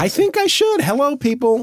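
high playful announcer voice; commercial voiceover. Pretend. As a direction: pitch it like a goofy ad. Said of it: "Roomba voiceover commercials".

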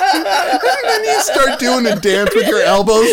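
laughing hard. Real, not acted. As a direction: get the words out while cracking up.

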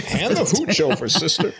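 high, hammed-up repeat through laughter; mock tough demand. Host repeating the bottle joke, high mock demand. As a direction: replay the silly demand, amused.

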